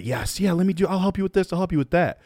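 mimicking his younger over-helpful leader self; eager people-pleaser. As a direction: gush to please everyone. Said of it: "I'm like, I'm the".

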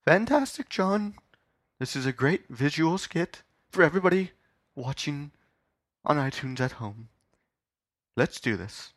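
high, halting, affected interviewer voice; interviewer character. Acted. [name] praising the visual skit, affectedly approving. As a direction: pitch it high and pause between phrases.

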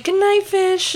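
high, sustained mock goodnight; singsong goodnight. They say goodnight to the pet fish, singsong. Retold bedtime farewell to a fish.

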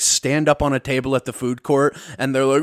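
ordinary talk

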